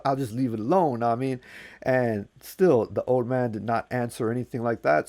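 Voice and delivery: ordinary delivery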